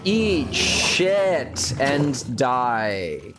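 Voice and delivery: very slow menacing taunt; slow drawn-out taunt. Pretend. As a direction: stretch every word, gloating. Killing enemy.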